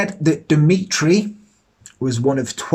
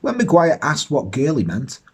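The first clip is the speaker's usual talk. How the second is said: normal talking voice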